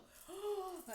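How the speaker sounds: high, audible gasp of wonder; awestruck gasp. Retold or imitated. Woman gasps after an angel's visit, awestruck. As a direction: gasp in retold amazement. Acts out her amazement.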